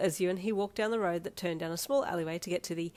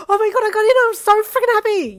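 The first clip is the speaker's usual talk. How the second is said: much higher, loud happy screech; 18 dB louder than usual. Acted. Teen shrieking acceptance news to boyfriend. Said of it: "you screeched".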